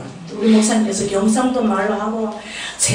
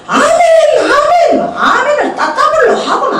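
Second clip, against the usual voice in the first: amens called out louder through laughter; laughing mock amens; 13 semitones higher, 5 dB louder than usual. Retelling her amen frenzy to the congregation, laughing and loud. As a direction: laugh while shouting the amens. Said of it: "아멘을 크게 해야 된대요".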